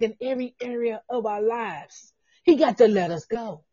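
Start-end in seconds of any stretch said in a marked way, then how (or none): none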